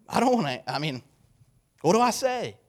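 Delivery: flustered worry. Acted. Nervous believer unsure how to share Jesus, mock flustered. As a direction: act out being stuck for an answer. Acts out fearful believer's hesitation.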